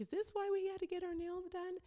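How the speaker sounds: high squeal of surprised delight; squealing fiancée. Retold or imitated. Fiancée reacting to the proposal, high squeal. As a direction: squeak it out like a thrilled bride-to-be.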